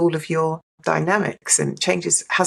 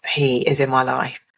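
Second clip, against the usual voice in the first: usual speaking voice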